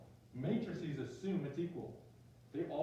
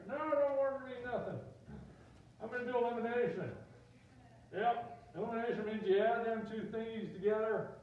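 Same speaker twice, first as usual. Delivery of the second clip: high, loud Southern griper; stubborn student with a hammed-up Southern twang; Southern accent; 6 semitones higher, 3 dB louder than usual. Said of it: "I click into Southern accents".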